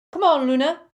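high, loud call; friendly loud call to Luna. A narrator's reading. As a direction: call to her as you hurry past. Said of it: "Dean called as he passed".

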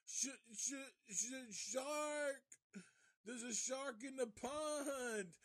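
high-pitched panicked stutter, imitated; stammer, then cry out the warning in mock terror; mock-frightened girl's high shriek; stuttering scared girl. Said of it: "this girl that's stuttering".

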